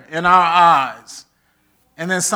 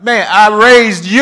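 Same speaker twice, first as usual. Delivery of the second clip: higher, louder indignant retort; indignant mother; 5 semitones higher, 8 dB louder than usual. Retold or imitated. Mother snapping back at her grown child, louder. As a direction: throw it back, offended.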